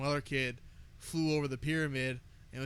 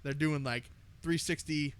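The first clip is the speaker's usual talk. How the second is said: usual speaking voice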